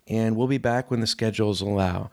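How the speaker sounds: ordinary delivery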